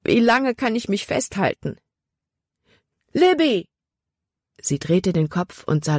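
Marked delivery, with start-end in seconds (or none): 0.0-1.8 high-pitched despairing question; desperate. A narrator's reading. Libby clings to the tree in the current, desperate. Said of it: "fragte sich Libby verzweifelt". 3.1-3.7 high call of a name, falling at the end; urgent call. A narrator's reading. Jordan in the stream calls to Libby, urgently.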